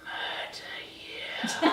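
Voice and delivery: a laughing murderous whisper; slow menacing whisper. Acted. Whispered threat.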